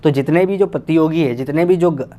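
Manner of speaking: usual speaking voice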